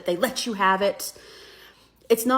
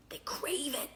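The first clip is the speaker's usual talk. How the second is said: hushed contemptuous whisper; sneering whisper; 5 semitones higher, 12 dB quieter than usual. Real, not acted. Speaker scorns voters who crave hate, whispered. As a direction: breathe it like a dark secret. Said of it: "sneering aside about voters".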